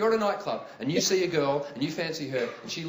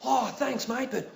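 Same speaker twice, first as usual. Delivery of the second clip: Steve Irwin voice; 5 semitones higher, slower than usual. Retold or imitated. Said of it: "Steve Irwin looked back at him and went".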